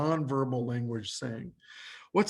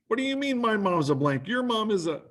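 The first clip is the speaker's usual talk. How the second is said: mock offended retort; 7 semitones higher than usual. Acted quarrel about mom.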